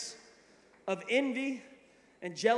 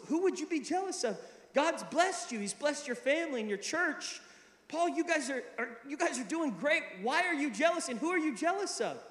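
high-pitched imitation of a puzzled mentor; mock disbelief, reassuring him; incredulous encouragement; 7 semitones higher than usual. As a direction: protest kindly, pitched well up. Mentor's puzzled, encouraging protest retold.

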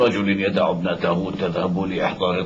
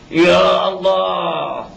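drawn-out, sung wail to God, much higher and louder; anguished cry to God; 8 semitones higher, 5 dB louder, much slower than usual. Acted. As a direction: stretch it into a slow keening cry. (صرخة حزن ممدودة منغّمة إلى الله)

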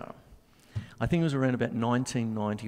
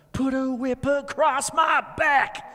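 hammed-up high, slow rock singer; acting; 11 semitones higher, 4 dB louder, slower than usual. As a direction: ham it up like a rock frontman. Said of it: "Sorry, I'm acting".

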